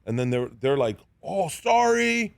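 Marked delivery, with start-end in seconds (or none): 1.2-2.3 higher, very slow "sorry"; drawn-out mock apology. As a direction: stretch out a called-out apology, imitating the players. Players calling out an apology.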